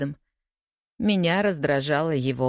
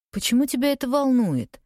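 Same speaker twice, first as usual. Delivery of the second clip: mildly exasperated, high-pitched moan; moaned complaint; 9 semitones higher than usual. A narrator's reading. Woman pressed about why she cried, moaning in protest. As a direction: groan the question, pitched well up. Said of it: "простонала я".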